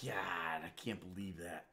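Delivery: low, soft, slow grumble; muttered disbelief. Real, not acted. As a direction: mutter it to yourself, defeated. Muttered after failure.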